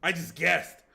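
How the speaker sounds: loud high triumphant claim; excited. Real, not acted. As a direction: shout it out proudly. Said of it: "game guess, repeated exclamation".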